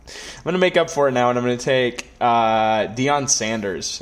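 normal talking voice

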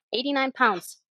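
ordinary talk, nothing marked

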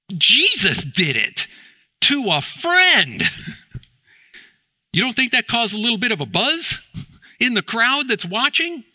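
high-pitched, worked-up disbelief; incredulous outrage. Acted. Preacher on Jesus opening a friend's tomb, incredulous. Voicing the onlookers' shock at the opened tomb.

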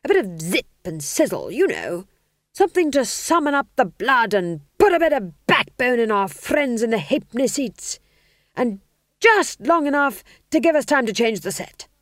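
a pushy manager barking orders at the writer; theatre manager voice. A narrator's reading. Manager's demand continues.

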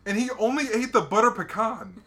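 amazed caller. Retold or imitated. Owner reports the bear's flavor, high and loud. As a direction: marvel at the detail. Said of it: "He goes".

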